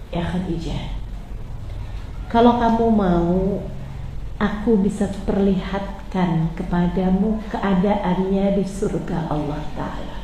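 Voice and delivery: soft, low, slow comfort; tender reassurance. Acted. Prophet tells grieving Khadijah of paradise, soft and slow. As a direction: reassure her gently.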